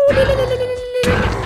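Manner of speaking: squeaky high "ले, ले" taunt. Acted. Gamer taunting the monster, high singsong. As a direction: tease the mob in a high singsong.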